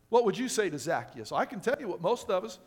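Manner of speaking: ordinary talk, nothing marked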